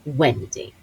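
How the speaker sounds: low, soft, withering British dame; Maggie Smith. Retold or imitated. Grand co-star looking down at panting actress, low British impersonation. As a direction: say the name down at them, grandly. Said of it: "that's the best Maggie Smith".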